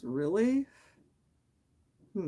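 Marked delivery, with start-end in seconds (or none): 0.0-0.7 "Really?" pitched high with a rising doubt; can't believe it, lift it high; incredulous really. Real, not acted. Streamer hearing people ask for numbers, incredulous. Reacting to chat about social security.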